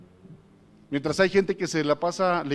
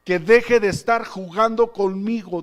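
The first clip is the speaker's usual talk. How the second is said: loud, slow, emphatic rebuke; Jesus rebuking; 4 semitones higher, 6 dB louder, slower than usual. Retold or imitated. Preacher repeats the Lord's warning to his people, slow stern rebuke. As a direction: drive each word home, slowly and loudly. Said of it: "dice Jesús".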